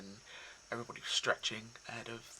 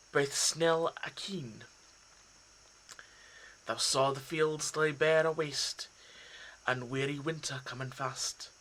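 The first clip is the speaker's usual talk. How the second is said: raised, loud Scottish recital of Burns; Scottish accent; 5 semitones higher, 6 dB louder than usual. Acted. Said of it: "throw in, uh, a Scottish accent".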